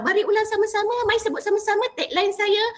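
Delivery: high-pitched call to join in; rallying the audience. Shown as a demonstration. Young preacher inviting audience to repeat the tagline, high and rallying. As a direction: urge them along, pitched well up.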